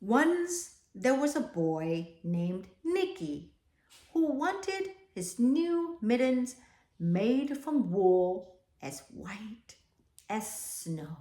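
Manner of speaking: put-on slow, even read-aloud to children; storybook reading. A narrator's reading. Teacher reading the class a picture book, slow storytelling.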